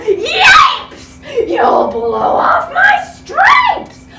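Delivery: high, loud panicked yelp; zebra yelling. Acted. Zebra yelling at the elephant, high loud panic. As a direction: shriek it out, dragging the stripes line. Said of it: "The zebra yelled".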